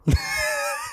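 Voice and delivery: very high breathless gasp of shock; imitated shocked shriek. Imitating the teacher's shocked scream at his mother. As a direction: shriek like a startled fan. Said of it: "ela fez assim, ó".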